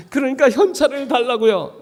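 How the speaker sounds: exasperated plea. Retold or imitated. Pleading to God.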